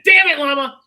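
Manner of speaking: high, loud scolding yell; loud high-pitched shout of exasperation. Acted. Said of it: "just yelling at you".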